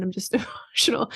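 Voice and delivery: whispery, voice giving out with a laugh; emotional. Retold or imitated. Mom reassures the crossing guard, emotional. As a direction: lose the voice on the last word, recounting tears.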